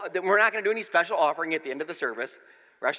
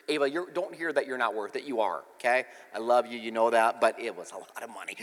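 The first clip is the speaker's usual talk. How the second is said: reassuring; 7 semitones lower, 4 dB quieter than usual. Real, not acted. Reassuring his daughter.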